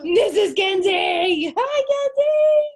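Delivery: high, loud and slow, like greeting a pet; excited greeting. Real, not acted. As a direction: call out to her brightly, drawn out. Greeting a dog arriving.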